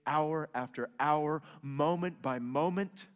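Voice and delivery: usual speaking voice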